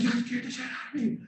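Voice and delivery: startled woman. Retold or imitated. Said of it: "she's like".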